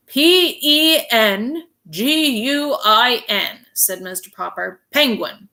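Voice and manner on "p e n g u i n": P E N G U I N, each letter slow and separate; spelled out. Shown as a demonstration. Mister Popper spells the word for the clerk, slowly. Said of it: "he had better spell it".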